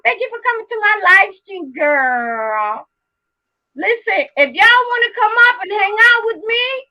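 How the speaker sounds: ordinary delivery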